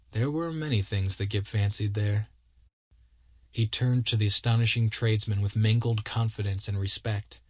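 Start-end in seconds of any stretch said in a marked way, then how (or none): none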